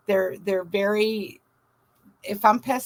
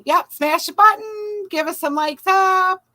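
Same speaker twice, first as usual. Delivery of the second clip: high, loud, slower call to viewers; hype call-out; 9 semitones higher, 6 dB louder than usual. Real, not acted. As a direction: pump up the chat to smash like. Hyping viewers to like stream.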